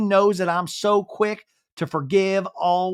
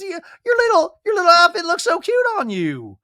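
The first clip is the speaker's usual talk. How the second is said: much higher, louder gushing; gushing compliment; 10 semitones higher, 5 dB louder than usual. Retold or imitated. Brittany gushing at Janet to change the subject, much higher. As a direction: gush over her outfit.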